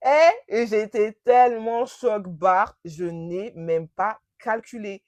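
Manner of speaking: TELLEMENT CHOC-BARRE loud and drawn out; slow right down and hammer "tellement choc-barre"; emphatic shock. Real, not acted. Telling how shocked she was, "tellement choc-barre" slow and loud.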